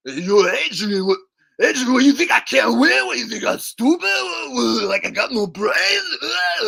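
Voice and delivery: loud, high, slurred wailing; slurring boxer impression. Protesting to Adrian he isn't stupid, loud boxer impression. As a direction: bellow like a wounded punch-drunk fighter.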